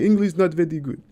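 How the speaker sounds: playful imitation of a Russian accent, stronger this time. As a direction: ham up the Russian driver's broken English. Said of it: "that's a spot on Russian accent".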